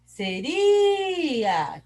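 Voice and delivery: RI in "Seria" stretched long and high; calling from afar. Shown as a demonstration. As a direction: call "Seria" into the distance, holding RI. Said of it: "Vamos chamar longe".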